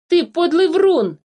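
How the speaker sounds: high, slow, drawn-out accusation; angry shouting. A narrator's reading. Philipp calls the changeling a liar, angry cry. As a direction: hurl the insult in outrage. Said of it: "закричал Филипп".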